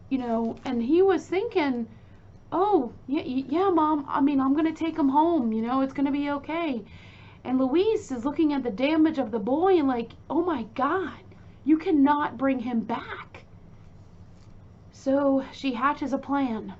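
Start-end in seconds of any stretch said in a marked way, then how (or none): 2.5-6.8 higher, louder imitation of hurried reassurance; acting out a son placating his mother, rushing toward the end. 10.3-13.5 higher, louder imitation of an appalled mother; appalled scolding. Mother appalled, scolding her son.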